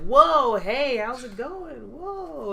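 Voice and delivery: high, slow goofy greeting voice; excited gamer kids. Retold or imitated. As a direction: play an eager group of kids. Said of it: "the entire group just came over towards me and was like".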